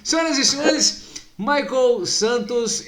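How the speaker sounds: loud, slow booming emcee call; grand entrance announcement. Acted. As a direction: announce the guest like a stadium host. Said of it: "Senhoras e senhores, que rufem os tambores".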